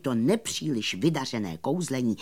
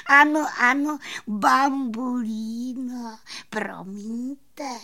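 high, slow fairy voice with drawn-out vowels; sighing little fairy; 11 semitones higher, slower than usual. A narrator's reading. Little fairy apologising to the magician, high drawn-out sighing. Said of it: "vzdychla malá víla plavůňka".

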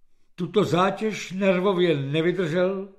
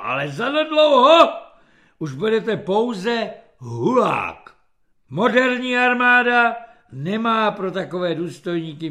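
general's threat; 4 semitones higher, 6 dB louder than usual. A narrator's reading. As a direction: pronounce his coming demotion with relish and force. General threatens the lieutenant with demotion. (hlasitá generálská hrozba)

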